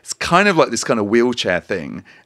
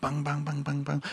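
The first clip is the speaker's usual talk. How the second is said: soft chanted jingle; 5 semitones higher, 13 dB quieter than usual. Pretend. Jingle sound effect for segment.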